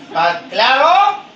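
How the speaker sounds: slow, loud call; slow drawn call. Real, not acted. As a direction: stretch it out for the crowd. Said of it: "call and response".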